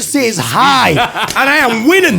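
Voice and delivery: high, crowing boast; gleeful triumph. Retold or imitated. Comic recalling he only needed to say hi, gleefully triumphant. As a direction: crow as if victory is already certain. Acting his triumph before going on.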